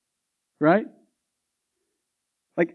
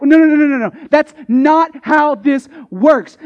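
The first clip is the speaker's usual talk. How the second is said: louder, higher repeated no; emphatic refusal; 5 semitones higher, 6 dB louder than usual. Acted. Paul refusing the question, loud repeated no. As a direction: slam the door on it.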